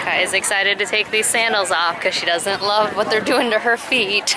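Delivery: ordinary talk, nothing marked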